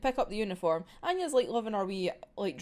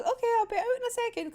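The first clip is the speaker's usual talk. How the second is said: Yor's reply; 13 semitones higher, faster than usual. Retold or imitated. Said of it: "Yor just goes".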